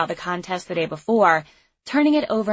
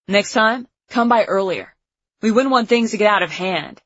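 calling out; 4 dB louder than usual. A narrator's reading. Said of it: "Cade called".

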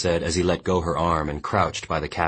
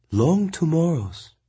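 higher, slow woman's echo; Mongnan echoing; 12 semitones higher, slower than usual. A narrator's reading. Mongnan slowly echoing the orphanage's name. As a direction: repeat it knowingly.

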